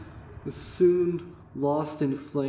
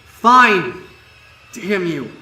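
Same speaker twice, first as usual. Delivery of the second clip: louder, higher, resentful snap; forced, angry; 7 semitones higher, 13 dB louder than usual. Acted. Narrator made to keep reading, bitter and loud. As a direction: spit it out grudgingly.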